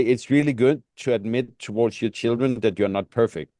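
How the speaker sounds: normal talking voice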